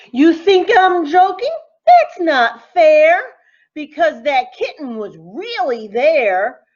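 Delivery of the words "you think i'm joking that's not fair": higher and louder indignation; indignant protest. Acted. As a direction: insist it's true, pitch up. Protesting to disbelieving listener.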